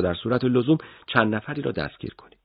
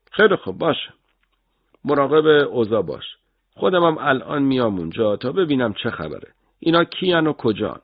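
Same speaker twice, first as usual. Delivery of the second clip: lightly raised, brisk orders; commanding officer; 7 semitones higher than usual. Acted. Officer ordering his agent on the radio, lightly brisk. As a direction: take charge over the radio. Narrator voicing himself as officer.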